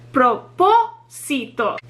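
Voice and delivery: "Propósito" slowly broken up, PO stressed; stress on third-to-last syllable. Shown as a demonstration. Teacher breaking down four syllables, slow stress on PO in "Propósito". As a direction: draw out PO in "Propósito", then let it fall. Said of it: "third to last syllable is the one that receives the stress".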